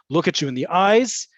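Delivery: ordinary delivery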